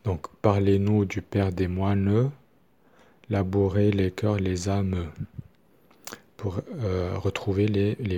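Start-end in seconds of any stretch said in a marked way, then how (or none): 0.4-5.1 "moines" said as "moin-eu" with a voiced final E; "âmes" sung as "â-meu", final E sounded. Shown as a demonstration. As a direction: pronounce the mute E at the end of "moines" and "âmes". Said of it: "il faut prononcer les E".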